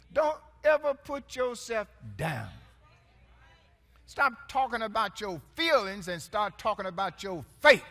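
loud, driving preacher's call; full-force preaching. Acted. Pastor preaching loud to his church. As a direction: lift the room with it.

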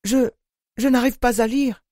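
high, stammering frightened plea; trembling voice. A narrator's reading. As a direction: falter through it, expecting a blow. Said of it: "d'une voix tremblante".

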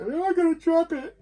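high, loud, squeaky mock panic; a hammed-up panicky squeal. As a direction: act out the fear of dropping it. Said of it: "I feel like".

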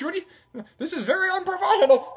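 high, fast huffy complaint; mock indignation. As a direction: fake outrage at the camera, pitched high. Scolding after camera mishap.

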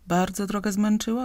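ordinary delivery, nothing marked

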